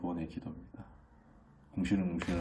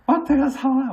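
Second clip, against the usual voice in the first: put-on bossy kid threatening; 8 dB louder than usual. His second child bossing dad to go buy it, high and loud. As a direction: drag out the command like a spoiled kid. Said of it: "너무 당당하게 요구를 하는 거예요".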